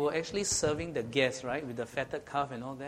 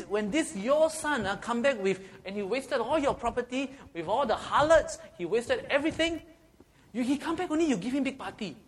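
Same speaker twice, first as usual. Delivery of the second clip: mocking indignation; 7 semitones higher, 4 dB louder than usual. Brother mocks father's party.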